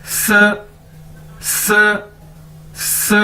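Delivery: स with teeth slightly open, closing forward; Chinese si sound. Shown as a demonstration. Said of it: "दांतों को थोड़ा सा खुला रख के".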